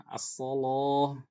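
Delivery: "As-soloh" slow, the lam thick; Warsh-style heavy lam. Shown as a demonstration. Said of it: "dibaca tebal lamnya".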